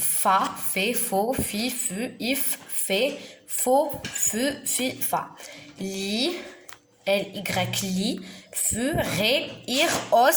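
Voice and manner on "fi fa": ordinary delivery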